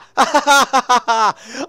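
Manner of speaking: loud, high peals of laughter; elated laugh. Real, not acted. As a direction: laugh wildly in triumph. Finally catches a fish.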